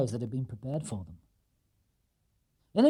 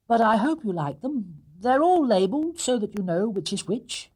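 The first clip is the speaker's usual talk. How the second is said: higher and louder little bear, hopeful; a young bear hoping they like the gifts; small bear voice; 8 semitones higher, 5 dB louder than usual. Acted. Paddington speaks.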